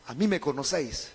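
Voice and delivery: low, very soft delivery; hushed restatement. Acted. Preacher explains Jesus' words to the congregation, quiet and low. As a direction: drop right down and repeat it quietly. Same verse repeated softly for explanation.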